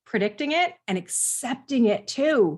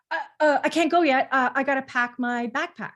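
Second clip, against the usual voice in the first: put-on quick, higher, hesitant child voice; lightly voiced son stalling at the door; 5 semitones higher than usual. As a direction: stammer out a last-minute excuse. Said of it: "he would say".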